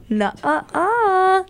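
drawn-out, high teasing refusal; sing-song withholding of a secret; playful refusal. Retold or imitated. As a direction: stretch it out high, refusing to tell. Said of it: "She's like".

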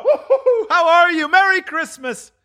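very high whooping, laughing glee; giddy jubilation. Acted. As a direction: whoop and greet, bubbling with laughter. Scrooge giddy as turkey arrives.

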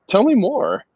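high, eager retold reply; lightly acted eagerness, lilting high; keen interest. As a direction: perk up, pitch jumping, intrigued. Retelling his keen reply.

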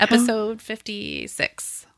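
quiet, slow questioning "fifty-six". Real, not acted. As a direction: draw out "fifty-six" softly, as if unsure. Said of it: "I said that in a questioning manner".

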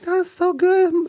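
very high, drawn-out mom falsetto; gushing mom. Retold or imitated. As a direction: gush like an adoring mom, slowly. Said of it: "she'd be like".

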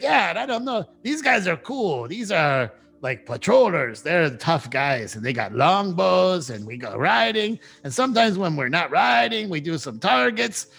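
high, bouncy wolf voice chanting along; a wolf gushing about patrol life; happy dire wolf. Acted. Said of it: "he's like".

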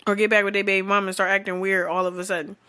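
ordinary talk

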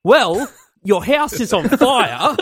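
mock emphatic retort. TV host answering his guest's reply, mock emphatic. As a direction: stretch out the punchline as the host. Acted host's retort in retold sketch.

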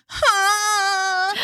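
high mock whining sob; mock drunk crying whine. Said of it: "whine crying".

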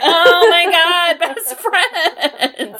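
high, drawn-out mock squeal with laughter; gushing delight. Podcaster voicing viewers moved by the friendship, gushing squeal. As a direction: gush over them like an overjoyed fan.

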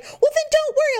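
high, mocking singsong dismissal; mock his casual reassurance; mimicking husband. Wife mocking husband's breezy advice, high and singsong. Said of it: "he's like".

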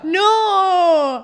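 high, very loud, stretched-out wail; amused disbelief. Real, not acted. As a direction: cry it out in laughing horror. Refusing to believe the picture.

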